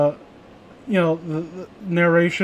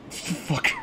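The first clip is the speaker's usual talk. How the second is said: laughing whisper; 10 dB quieter than usual. Real, not acted. Laughing insult at teaser.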